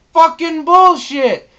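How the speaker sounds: furious shout at the game; raging gamer. Retold or imitated. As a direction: scream it in frustration. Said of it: "you'll just say".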